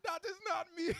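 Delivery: put-on Jamaican-accented denial broken up by laughter; Jamaican accent, laughing. Amused client denying the debt, laughing Jamaican accent. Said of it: "I can't do the accent".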